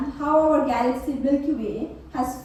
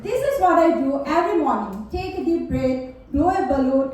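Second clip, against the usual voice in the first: ordinary talk, nothing marked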